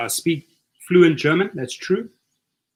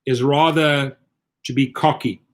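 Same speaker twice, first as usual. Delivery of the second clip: usual speaking voice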